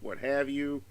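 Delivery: usual speaking voice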